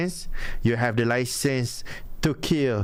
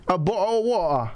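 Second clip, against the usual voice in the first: mock British accent, higher, glottal stop in bo-; British glottal demo; 5 semitones higher than usual. Shown as a demonstration. Showing how Brits say bottle of water, mock British accent. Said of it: "You just need to practice like that, mate".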